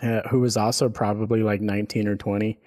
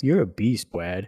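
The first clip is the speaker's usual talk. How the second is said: mocking voice. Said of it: "I'm doing that voice".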